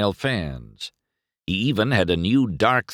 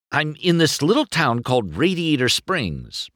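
mildly excited, higher voice; understated enthusiasm; 6 semitones higher than usual. A narrator's reading. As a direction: explain it with eager lift. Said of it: "McQueen explained excitedly".

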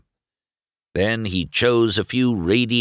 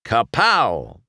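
showing off; 4 semitones higher, 4 dB louder than usual. A narrator's reading. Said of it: "showing off his new look".

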